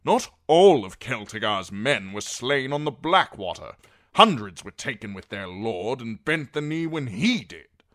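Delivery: loud, emphatic argument; forceful knight's accusation; Sir Axel, insistent. A narrator's reading.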